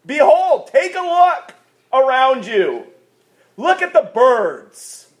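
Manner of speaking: high, emphatic preaching call; proclaiming scripture. Acted. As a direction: call the congregation to look. Preacher proclaims Jesus' words.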